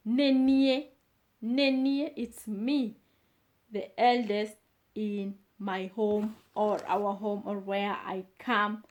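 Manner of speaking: ordinary talk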